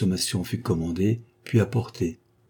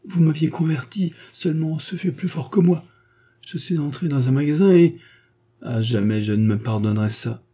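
a hint of a pleading young woman; 7 semitones higher, 3 dB louder than usual. A narrator's reading. Odette pleading her excuse.

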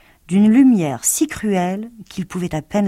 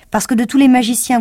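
higher and louder flattery; eager plea; 6 semitones higher, 8 dB louder than usual. Acted. Lion flattering Oz to win courage, eager. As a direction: press your case eagerly.

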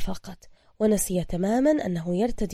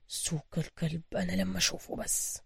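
angry mutter; 2 semitones lower, 9 dB quieter than usual. A narrator's reading. Hero privately cursing his absent sidekick, angry mutter. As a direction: mutter the threat under your breath. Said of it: "تمتم بروس بغضب".

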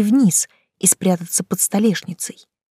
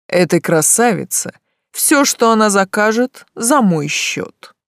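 grandly generous; 2 semitones higher than usual. A narrator's reading. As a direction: show off, lavish and slow. Demon flirts with grand gesture.

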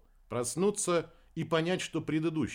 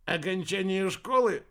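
higher-pitched, teasing question; smirking; 7 semitones higher, 4 dB louder than usual. A narrator's reading. Captain teasing Deber about the celebration, smirking. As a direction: ask with a smirk. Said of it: "усмехнулся капитан".

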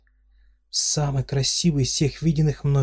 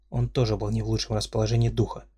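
plain reading voice

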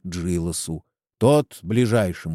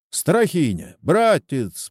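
higher-pitched friendly hail; calling someone over; 6 semitones higher, slower than usual. A narrator's reading. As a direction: hail a passer-by without shouting. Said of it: "подозвал его староста". (подзывая, повышенным тоном)

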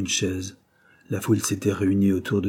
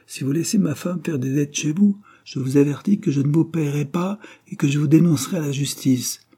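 higher, forceful warning; threatening warning; 6 semitones higher than usual. A narrator's reading. Brum retelling his threat to the Banks, warning them. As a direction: warn them firmly, meant to frighten. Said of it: "il fallait leur faire peur".

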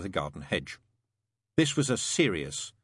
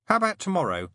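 put-on child's question; 9 semitones higher, 4 dB louder, faster than usual. A narrator's reading. Wensleydale, a child, asks.

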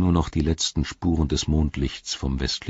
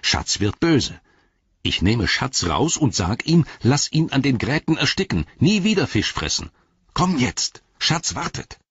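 higher-pitched threat, climbing as it goes; all-out urging; 8 semitones higher than usual. A narrator's reading. Frodo staking all to lure Gollum, urgent and threatening. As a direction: press him hard with the threat. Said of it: "sagte Frodo aufs Ganze gehend".

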